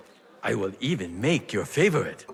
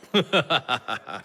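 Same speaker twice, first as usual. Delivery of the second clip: short chuckle at his own joke; amused chuckle. Acted. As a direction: laugh off the crate plan. Laughing at own plan.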